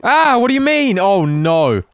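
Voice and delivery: high, loud exasperated shouting, slow; loud indignant gamer rant; outraged yell. Real, not acted. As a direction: cry out at the unfair hit. Takes big damage, outraged.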